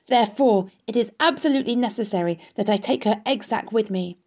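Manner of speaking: high, pressing, pleading voice; urgent cry. A narrator's reading. Wilbur begs the rat to fetch the egg sac, urgent cry. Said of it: "cried Wilbur".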